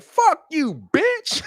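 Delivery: shrill high mock of Elon cursing, rising at the end; squealing insult. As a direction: fire it off in a squeaky high pitch. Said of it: "Elon was like".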